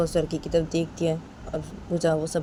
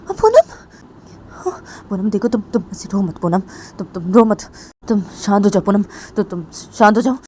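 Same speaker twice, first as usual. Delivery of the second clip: comforting; 3 semitones higher, 8 dB louder than usual. Acted. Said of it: "पूजा वो पूनम को बोलती".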